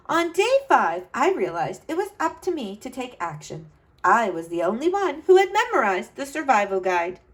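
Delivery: put-on bright, lively read-aloud to kids; storybook narration. A narrator's reading. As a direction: tell it like a storyteller for children. Reads picture book aloud.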